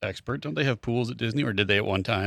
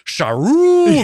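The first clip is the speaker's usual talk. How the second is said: put-on high, loud mooing cow accent; Stewie's cow with an accent; 9 dB louder than usual. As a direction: moo it like a foreign cow. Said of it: "the cow says".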